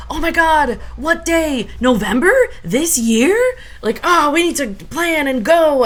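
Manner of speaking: high, loud burst of excited questions; cheering relatives. Retold or imitated. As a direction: imitate a thrilled family all piling in. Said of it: "cheering and congratulating".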